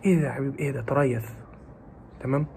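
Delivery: usual speaking voice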